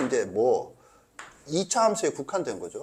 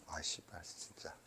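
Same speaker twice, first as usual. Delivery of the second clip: muttered swear, very soft; cursing under breath; 8 semitones lower, 20 dB quieter, slower than usual. Real, not acted. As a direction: curse quietly to yourself.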